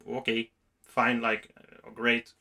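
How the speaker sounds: low, slow, quiet acceptance; unenthused polite response. Retold or imitated. Listener to a friend's boast of cheating, unenthused. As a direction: let each word drop, subdued and noncommittal. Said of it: "and you're just like".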